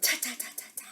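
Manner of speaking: soft, staccato monkey noises; quiet storybook monkey chitter; monkey chatter. A narrator's reading. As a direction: imitate mocking monkeys in little repeated syllables. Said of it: "monkeys only stomped their feet and said".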